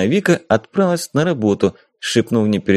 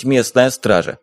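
usual speaking voice

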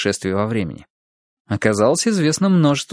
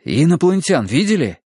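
eager question, pitched up; keen, agitated question; excited blurting; 2 semitones higher than usual. A narrator's reading. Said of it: "выпалил взволнованный Валера".

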